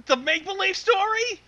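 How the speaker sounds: usual speaking voice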